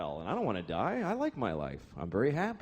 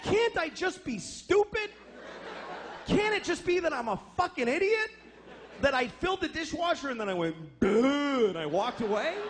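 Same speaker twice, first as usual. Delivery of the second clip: whiny pleading; 7 semitones higher than usual. Acted. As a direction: beg to be allowed to be stupid, pitched up. Pleading with his wife.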